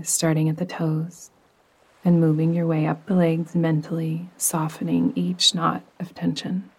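calm, unhurried meditation voice with long pauses; soothing yoga nidra narration, evenly paced; guided meditation. Acted. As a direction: guide the listener gently down into rest.

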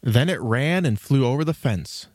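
ordinary delivery

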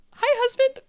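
high, chirpy hello to husband; cheery greeting. Retold or imitated. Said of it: "her just being like".

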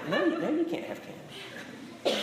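slow no-no said through a laugh; dad laughing as he refuses the candy; laughing refusal. Retold or imitated. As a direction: chuckle while refusing the toddler. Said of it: "And I'm like".

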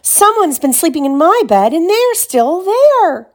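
alarmed little bear, rising and stretching out; Baby Bear crying out. A narrator's reading. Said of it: "he cried".

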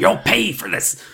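hammed-up villain threat; mock threat. Dummy threatening the kids who crushed him, mock threat.

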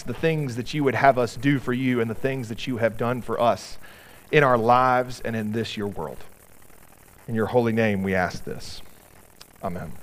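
soft, low prayerful voice. Acted. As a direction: pray it quietly and reverently. Leads prayer.

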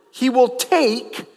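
drawn-out weight on "take"; slow down and lean hard on "take"; stress on take. Real, not acted. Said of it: "I emphasize the word over and over".

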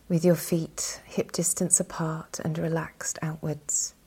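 ordinary talk

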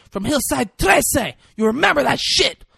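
hammed-up high-pitched threat; mock freak-out. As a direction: play the freaked-out girl, high and defiant. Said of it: "this girl starts freaking out".

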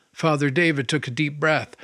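usual speaking voice

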